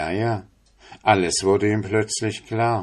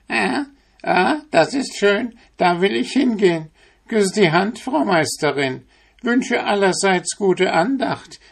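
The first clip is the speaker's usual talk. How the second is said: much higher, sugary mock-polite voice; sly apprentice; 12 semitones higher, 3 dB louder than usual. Devilish apprentice thanking the master's wife, high and mock-sweet. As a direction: fawn over the mistress with fake piety.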